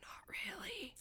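mock-menacing whisper. Teasing whisper to a friend after a joke threat. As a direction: breathe it as a playful threat.

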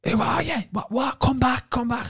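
very high, frantic retold calling; high-pitched mock-desperate yell; panicked shouting. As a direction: call desperately after someone leaving, voice way up. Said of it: "And I'm shouting him".

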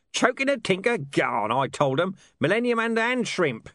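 put-on high, loud, garbled rant of a mad old beggar; crazed beggar shouting gibberish; foul old Ron. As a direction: babble nonsense at full tilt.

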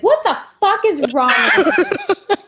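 high, loud incredulous outburst; mock outrage. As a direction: play up the horrified parent. Mock outraged reply to kids.

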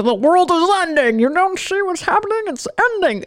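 high mock panic; mock doomsday panic. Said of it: "everybody running amok".

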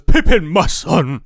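loud, slow, overjoyed cry; doting father. Retold or imitated. Father reunited with his son, loud and slow. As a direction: call out to your long-lost son. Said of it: "and he goes".

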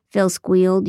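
ordinary delivery

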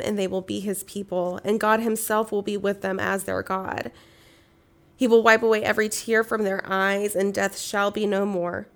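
solemn, measured Bible reading aloud; scripture reading. Acted. Reads Revelation aloud.

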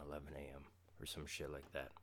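ordinary talk, nothing marked

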